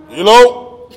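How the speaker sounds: loud, high, pointed Hello; boss calling you over. Retold or imitated. Vince summoning the wrestler after the match, loud pointed call. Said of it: "he looks at me and goes".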